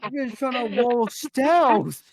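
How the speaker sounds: high, loud, slow laughing whine; laughing protest. Real, not acted. S1 protesting to the table while laughing, high and drawn out. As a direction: push the words out through laughter.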